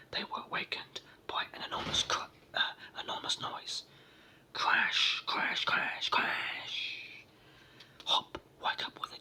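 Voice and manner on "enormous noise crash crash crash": ordinary delivery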